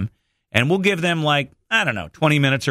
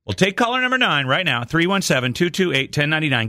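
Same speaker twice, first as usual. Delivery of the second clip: higher upbeat DJ call-in read; radio contest promo; 5 semitones higher than usual. Acted. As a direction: pitch the number like a radio host. Host reads call-in number.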